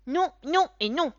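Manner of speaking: put-on high, loud child's defiant no; stubborn refusal. A narrator's reading. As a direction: stamp your foot like a stubborn boy. (refus buté d'enfant, aigu et fort)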